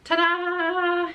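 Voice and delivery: high, loud showy ta-da; ta-da reveal. Real, not acted. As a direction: present it with a flourish. Showing off finished stitch.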